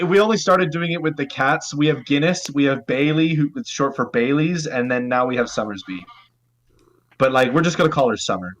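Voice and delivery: usual speaking voice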